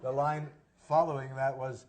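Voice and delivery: ordinary delivery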